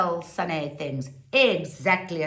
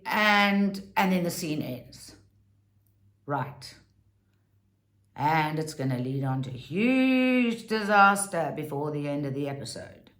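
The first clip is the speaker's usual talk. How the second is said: ordinary talk, nothing marked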